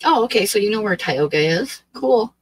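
normal talking voice

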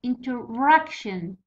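Interaction slowly, leaning on 'rac'; stress on RAC. Shown as a demonstration. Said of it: "وهو را".

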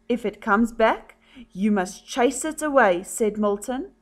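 gentle-volume storybook voice; story reading to children. A narrator's reading. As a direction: read a picture book softly, lifting the quotes. Story continues with two characters.

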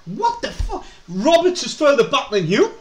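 high-pitched real disbelief; incredulous outburst. Real, not acted. Fan spots defender out of position, high incredulous cry. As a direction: shoot the pitch right up in astonishment.

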